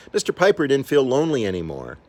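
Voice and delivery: plain reading voice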